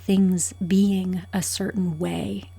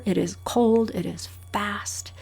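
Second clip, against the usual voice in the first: ordinary talk, nothing marked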